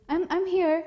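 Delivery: put-on high-pitched little boy's reply; evasive child. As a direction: answer high, like a dodging child. Child answers while avoiding her look.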